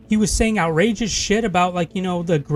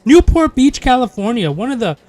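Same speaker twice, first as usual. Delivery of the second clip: louder and higher, indignant over Newport Beach; heated rant; 4 semitones higher, 6 dB louder than usual. Real, not acted. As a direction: hammer the place name in outrage. Angry rant about coworker's building.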